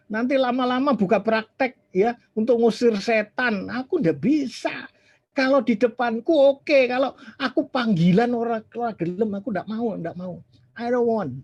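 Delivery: high-pitched, loud acted refusal; agitated protest. Pastor retelling his protest against becoming an on-call exorcist, agitated refusal.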